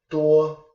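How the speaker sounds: "duo" held high and level; first tone. Shown as a demonstration. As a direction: say "duo" on a high, flat, sustained pitch. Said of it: "a first tone".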